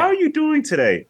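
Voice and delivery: very high falsetto greeting, falling at the end; mock high-pitched female character voice; Holly. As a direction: play a cartoon woman in squeaky falsetto. Voicing Holly greeting someone.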